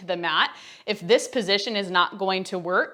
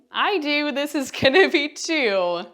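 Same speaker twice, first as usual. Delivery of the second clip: shaky voice; 7 semitones higher, slower than usual. Real, not acted. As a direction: voice shaking from effort. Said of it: "Do you hear my voice shaking?".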